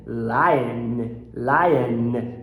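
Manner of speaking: lion ending in a schwa and a held N; low, drawn-out lion with a long final N; schwa plus N ending. Shown as a demonstration. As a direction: let lion close on schwa and N. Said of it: "Você acaba com um schwa mais um N".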